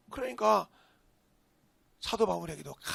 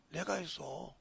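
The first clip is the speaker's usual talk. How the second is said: ordinary delivery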